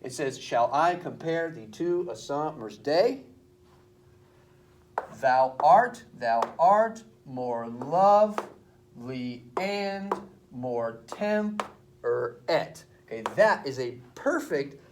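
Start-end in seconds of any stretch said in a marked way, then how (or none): 0.4-3.2 slow sing-song scansion, "day" rising sharply; weight every second syllable, "I", "pare", "day"; metrical stress. Shown as a demonstration. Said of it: "accent is on the second". 5.2-12.8 very slow foot-by-foot scansion, punching "art", stretching "and"; iambic stress. Shown as a demonstration. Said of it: "the accent is always on the second syllable".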